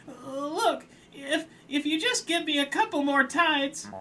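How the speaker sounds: pleading crab. Acted. Crab begs for more time.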